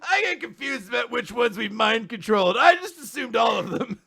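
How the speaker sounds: naive Asta. Retold or imitated. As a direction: play the clueless hero, yelling his excuse. Asta voiced in retelling.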